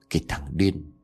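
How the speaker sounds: low, soft insult; muttered curse. A narrator's reading. Hữu insulting Công from the pond bank, low muttered curse. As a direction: curse him under your breath. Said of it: "Hữu buông tiếng chửi".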